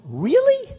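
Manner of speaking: high, loud startled really; mock surprised really. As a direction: play her amazement at the offer. Kennedy offered ambassador to Japan.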